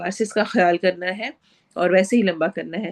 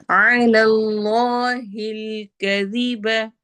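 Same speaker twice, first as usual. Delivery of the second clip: slow, drawn-out tajweed chant; Quran recitation; much slower than usual. Shown as a demonstration. Teacher recites verse for students, slow tajweed chant. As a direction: recite it slowly, stretching each sound. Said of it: "जाल को हमने नरमी से पढ़ना है".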